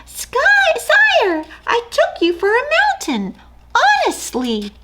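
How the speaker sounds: tiny squeaky mouse, quaking; timid mouse squeak. Acted. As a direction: squeak it out, terrified. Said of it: "found barely enough courage to squeak".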